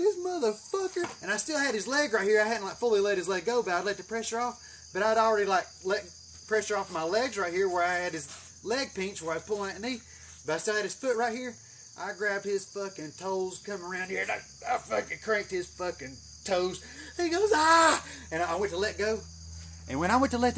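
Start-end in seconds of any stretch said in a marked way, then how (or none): 0.0-1.1 indignant inner voice, retold; retold outrage. Retold angry thought mid-fight. 17.5-18.0 high, loud mock scream of pain; short high cry, acted out; imitated scream. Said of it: "screamed like a little girl".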